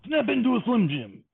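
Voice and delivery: high-pitched, hammed-up wrestler catchphrase; put-on pitchman's cry; distant catchphrase cry. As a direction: belt out a mock ad slogan in a high promo voice. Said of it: "you suddenly hear from the distance say".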